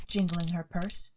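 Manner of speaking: plain reading voice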